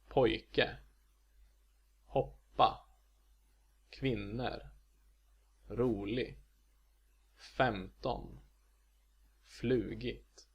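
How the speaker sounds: Pojke, hoppa, kvinnor, rolig, femton, flugit, first-syllable stress, double-peaked tone; Swedish accent two. Shown as a demonstration. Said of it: "Accent two is found in".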